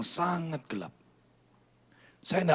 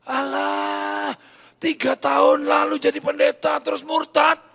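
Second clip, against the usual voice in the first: mocking friends; 12 semitones higher, 8 dB louder, slower than usual. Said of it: "Mereka bilang gini".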